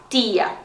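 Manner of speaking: tia with a soft dental t, "tee-ah"; soft pure t. Shown as a demonstration. Teacher repeating Spanish tia, soft t. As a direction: keep the t of tia free of any ch. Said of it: "também é bem suave".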